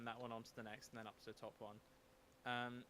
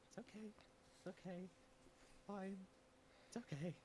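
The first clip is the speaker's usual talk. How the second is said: small, higher-pitched self-soothing mutter; muttering under breath; 5 semitones higher, 6 dB quieter than usual. Acted. Game master voicing Jenkins following along, muttering under his breath. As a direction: mumble it softly to yourself, pitched up. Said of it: "muttering under his breath".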